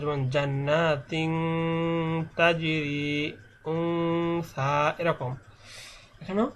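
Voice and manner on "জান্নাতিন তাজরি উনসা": "জান্নাতিন তাজরি" slow, n held long through the nose; ghunnah recitation. Shown as a demonstration. Teacher showing ikhfa with ghunnah, slow nasal chant. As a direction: chant "জান্নাতিন তাজরি", humming on each hidden n. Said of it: "সেখানে গুণনা করে পড়তে হবে".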